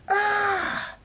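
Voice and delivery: high, loud drawn-out scream. A narrator's reading. Thunderstorm screams as lightning crackles, screaming. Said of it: "Thunderstorm screams".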